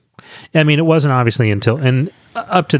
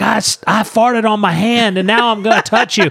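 high, silly voice of a schoolyard prankster; gross-out kid taunt; 10 semitones higher than usual. Retold or imitated. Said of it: "She's like".

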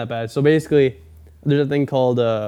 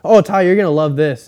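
imitating the salesman; 3 semitones higher, 7 dB louder than usual. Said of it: "I all of a sudden hear".

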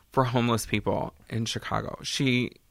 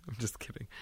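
whispered laugh; 3 semitones lower, 20 dB quieter, faster than usual. Real, not acted. Laughing tease after joking remark.

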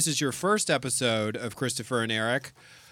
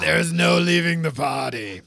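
lightly growled grouchy monster voice; a hint of a grumpy puppet croak; half-hearted Oscar the Grouch growl; 3 semitones higher, 4 dB louder than usual. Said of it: "I don't know what that accent was".

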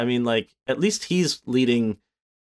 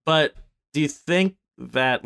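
ordinary talk, nothing marked

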